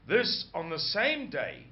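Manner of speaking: ordinary talk, nothing marked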